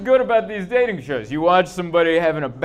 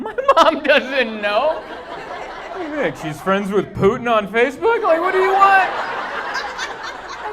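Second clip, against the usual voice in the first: exasperated mock reply; 9 semitones higher than usual. Mocking own confused reply.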